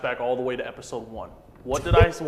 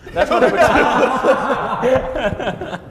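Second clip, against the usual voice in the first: high, loud, slow laugh through it; 9 semitones higher, 7 dB louder, much slower than usual. Real, not acted. Laughs at own pick.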